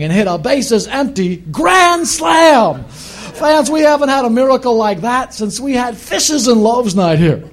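put-on booming old-school baseball announcer; Harry Caray impression. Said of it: "This is Harry Carey".

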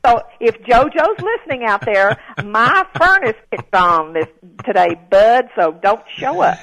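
loud, playful message over the air; mock announcement. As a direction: call it out firmly to someone listening.

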